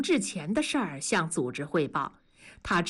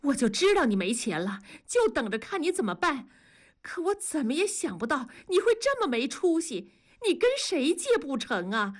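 rising, much higher scolding, quick at first; angry wife; 8 semitones higher than usual. Acted. Wife confronts husband over borrowing. (妻子质问丈夫借钱，又气又急)

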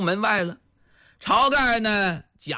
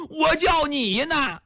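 high, drawn-out call; insistent calling out; 6 semitones higher, slower than usual. Acted. Captive insisting to his pretend uncle, high drawn-out call. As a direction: raise the pitch and stretch it to get his attention.

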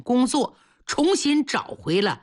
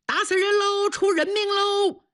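alarmed yell; 10 semitones higher than usual. A narrator's reading. Prisoners crying out after a punch lands, alarmed yell. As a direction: raise the alarm in a high calling voice. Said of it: "然后就大喊".

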